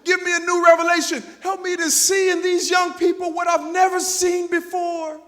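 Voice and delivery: loud, high cry to God for a new revelation; a fervent shouted plea; shouted prayer. Retold or imitated. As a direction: call out to God with full force.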